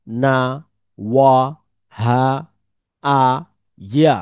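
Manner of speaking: each letter sounded out separately with a pause after it; reciting Arabic letters with fatha. Shown as a demonstration. Teacher drills students on the Arabic letters, reciting each one. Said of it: "তাহলে আমরা বলতে পারি".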